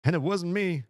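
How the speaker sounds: put-on high, bright-eyed kid voice; a lightly voiced Wes. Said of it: "gotta do the Wes voice".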